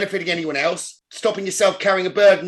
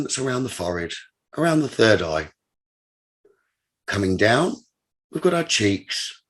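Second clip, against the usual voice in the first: slow, lower-pitched meditation voice, spaced phrases; low, unhurried relaxation guide with long pauses; guided meditation; 4 semitones lower, 3 dB louder than usual. Acted. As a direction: lead them through breathing, measured and deliberate. Leads a breathing meditation.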